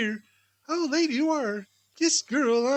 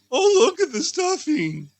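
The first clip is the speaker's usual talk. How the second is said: normal talking voice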